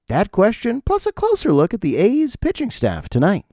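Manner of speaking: high, hammed-up news teaser; local TV news promo. Comic mocking local TV news, promo anchor voice. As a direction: parody an anchor teasing tonight's story. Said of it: "How shitty is local T V news?".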